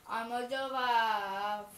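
slow proud announcement. Pretend. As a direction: drag it out proudly. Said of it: "we're gonna go do".

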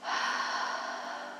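long, audible breath out; demonstrated exhale. Shown as a demonstration. Guide shows listeners how to exhale, long sighing breath out. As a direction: breathe out slowly in one soft, voiceless sigh. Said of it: "then exhale out like this".

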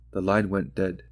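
plain reading voice

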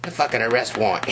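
usual speaking voice

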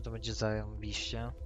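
ordinary talk, nothing marked

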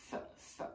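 f, f breathed voiceless and soft; sounding out f. Shown as a demonstration. Teacher sounding out the letter F for kids. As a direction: hiss the f sound out. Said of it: "on this sign it says".

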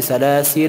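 سلاسل with drawn-out final -laa; سلاسل ending on a held alif; wrong long ending. Shown as a demonstration. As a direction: wrongly stretch the end of سلاسل into aa. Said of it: "نمیگیم که".

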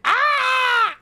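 very high, hammed-up scream; mock panicked scream. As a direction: scream it as if fleeing in terror. He runs out of the house screaming.

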